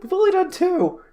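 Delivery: high, loud disbelief; rising panic at the mistake; incredulous. Real, not acted. Realizing repeated topics.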